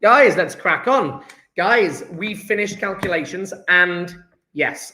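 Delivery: normal talking voice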